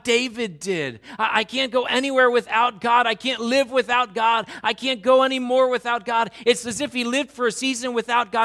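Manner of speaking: fervent sermon delivery; full-force preaching. Acted. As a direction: press the congregation with rising intensity.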